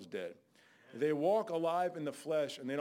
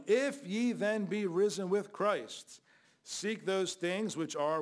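slow scripture reading; 3 semitones higher, 5 dB louder, slower than usual. Acted. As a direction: read each word slowly, weighed. Preacher reading scripture aloud.

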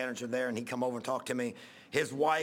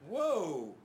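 startled whoa; 5 semitones higher than usual. Retold or imitated. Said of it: "Everybody started laughing 'cause I went".

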